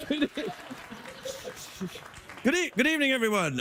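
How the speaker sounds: lightly voiced Scottish accent as a puppet host; a hint of Scottish, played as the puppet Drew. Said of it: "I'm using this Scottish accent".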